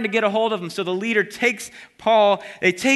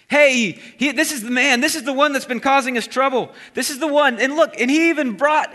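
raised, accusing voice of the crowd; accusing shout; 6 semitones higher, 3 dB louder than usual. Acted. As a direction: point the finger and cry out against him.

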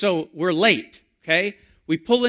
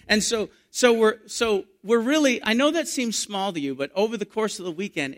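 ordinary talk, nothing marked

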